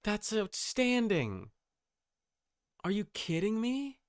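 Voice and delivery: ordinary talk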